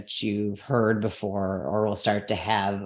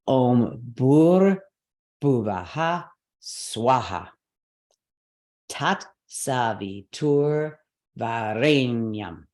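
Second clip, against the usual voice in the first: slow mantra, each stressed syllable pushed hard; exaggerated stress; much slower than usual. Shown as a demonstration. Teacher demoing mantra stress, slow and exaggerated. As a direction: overdo the stress on every word. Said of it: "I'm going to be very, obvious about the stress".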